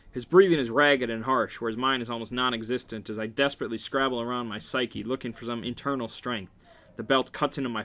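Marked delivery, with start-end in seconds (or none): none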